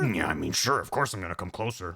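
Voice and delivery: low, casual action-hero voice; low-voiced obliging dope; gullible hero. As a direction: agree readily in a deep mock-hero voice. Snake answers in low voice.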